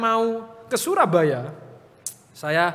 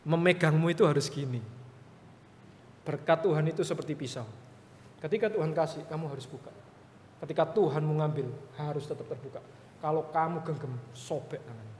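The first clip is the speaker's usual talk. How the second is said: much softer, low and grave; soft solemn counsel; 3 semitones lower, 7 dB quieter than usual. Retold or imitated. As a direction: hush it like a sacred lesson. Pendeta memberi nasihat rohani. (menasihati dengan lembut dan pelan)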